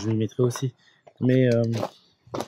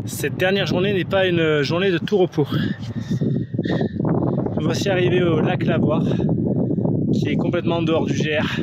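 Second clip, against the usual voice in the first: travel vlog narration; 4 semitones higher than usual. Acted. Voiceover narrating the hike.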